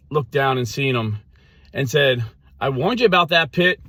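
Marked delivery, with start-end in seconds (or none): 2.6-3.8 high, loud mock scolding; hammed-up legalist reproach. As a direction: tell them off from above, pitch raised.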